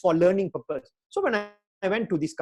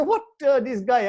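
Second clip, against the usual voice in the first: retold angry reaction; 5 semitones higher, 4 dB louder than usual. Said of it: "So I get very angry".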